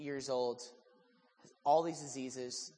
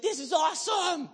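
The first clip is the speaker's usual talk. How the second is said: loud, much higher thrilled shout; excited shout; 10 dB louder than usual. Retold or imitated. Watching people healed all round, a thrilled shout. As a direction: shout it out in sheer delight. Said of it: "And I'm just like".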